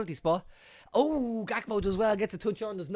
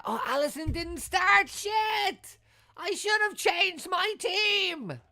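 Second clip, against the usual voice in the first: high cry of shit, then slow regret; real dismay; much slower than usual. Real, not acted. Fantasy player finding his keeper benched, yelping in dismay. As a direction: realise the mistake with a yelp. Fantasy team blunder discovered.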